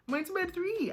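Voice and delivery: high, exasperated repeat of three; stunned at her own bedtime; incredulous. Real, not acted. Repeating her bedtime, high and incredulous.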